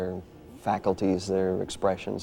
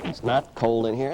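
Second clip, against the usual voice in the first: usual speaking voice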